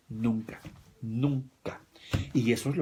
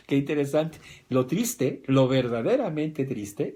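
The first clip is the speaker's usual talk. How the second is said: ordinary delivery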